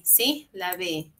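ordinary delivery

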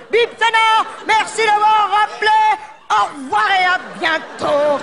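high and slow sign-off, drawn out; silly high voicemail. Shown as a demonstration. Ending the silly voicemail greeting, slow and high. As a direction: stretch the goodbye in a squeaky voice. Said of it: "je vais faire un truc plus rigolo".

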